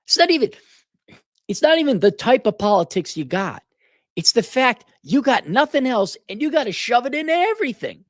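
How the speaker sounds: high-pitched, worked-up outburst; exasperated rant. Real, not acted. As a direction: let the voice shoot up in real exasperation. Ranting at politicized creators.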